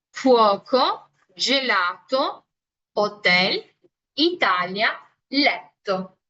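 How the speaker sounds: fuoco, gelato, hotel, Italia, letto, each word clear and separate; model Italian words. Shown as a demonstration. Teacher repeats the word list for students to copy. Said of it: "repito una vez más".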